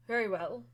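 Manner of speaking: heavy sigh, then a grudging reply; a weary, exasperated sigh before giving in. Acted. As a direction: sigh out the annoyance, then concede. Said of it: "it's exasperating to her".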